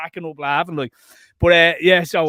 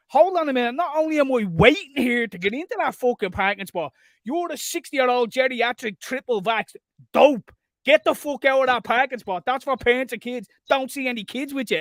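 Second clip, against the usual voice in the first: higher, heated rant; snapped; 7 semitones higher than usual. Retold or imitated. Telling off the man in the parent spot, heated rant. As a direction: rant at him, raised. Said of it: "I snapped, I did".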